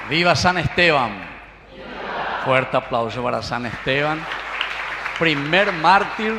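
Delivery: loud rallying cheer to the congregation; festive cheering. Real, not acted. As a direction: call it out to the whole church. Priest leads cheers, applause.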